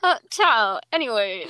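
lightly put-on drag queen catchphrase; Jada Essence Hall. Friend mimics a viral catchphrase for her co-host, lightly imitated. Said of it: "You sound like Jada Essence Hall in here".

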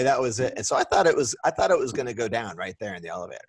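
normal talking voice

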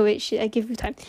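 ordinary talk, nothing marked

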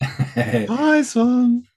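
high put-on greeting, laughing; a lightly voiced playful ghost. Answers in put-on voice.

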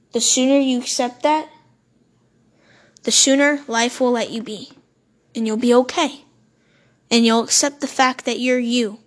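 normal talking voice

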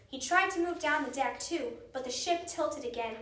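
ordinary delivery

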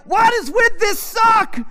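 high, loud, slow mock outrage; hammed-up fury over a sock; shouting at his wife about a sock; exasperated shout. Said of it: "I'm like".

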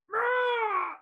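high, loud shouted monster roar. Retold or imitated. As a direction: roar like a giant monster bursting through clouds. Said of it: "the Clovey monster goes".

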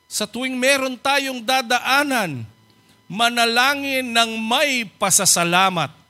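slow, emphatic exhortation; preacher urging the flock to pray thankfully, emphatic; preaching emphasis. Real, not acted. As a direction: press each word home.

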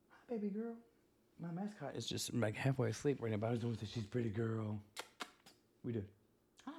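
soft, tender voice to a sleepy dog. Real, not acted. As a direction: coo gently so she stays asleep. Talks softly to sleepy pet.

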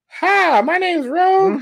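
high, slow Southern belle voice; Rogue voice. Acted. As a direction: purr it sweetly as Rogue. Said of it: "acting this out".

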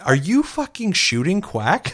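high and slow, each word drawn out; imitating Sarah accusing, high and slow; outraged disbelief. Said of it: "She's like".

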